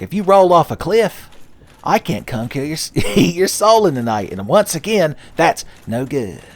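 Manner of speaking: a hint of cartoon Sonic lecturing; Sonic impression. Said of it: "every impression I have of Sonic the Hedgehog".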